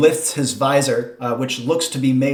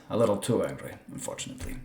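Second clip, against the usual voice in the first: softer, lower rueful aside, dying away; 5 semitones lower, 11 dB quieter, faster than usual. Acted. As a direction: say it through a small regretful smile. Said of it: "He smiles ruefully and says".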